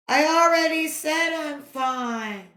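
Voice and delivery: exasperated line returned from afar; echo. A narrator's reading. Echo throwing back the boy's yell, distant. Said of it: "came back from across the valley".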